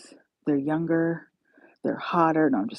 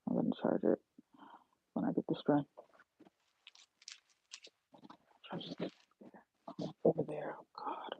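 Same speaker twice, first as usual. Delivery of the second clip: drained, barely voiced whisper; 12 dB quieter than usual. Real, not acted. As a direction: mumble it out, exhausted. Tired aside while phone dies.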